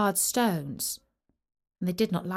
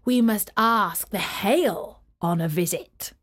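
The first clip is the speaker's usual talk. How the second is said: north wind. Acted. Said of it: "he said".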